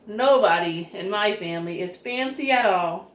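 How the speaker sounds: usual speaking voice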